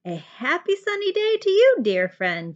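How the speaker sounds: high, loud happy greeting; cheerful exclamation. A narrator's reading. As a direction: beam it out to a friend. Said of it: "Jubal exclaimed".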